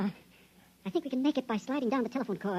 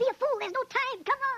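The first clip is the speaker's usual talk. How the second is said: urgent shout; 13 semitones higher than usual. Acted. With fire spreading, shouting at his friend to come on. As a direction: shout him away from the fire.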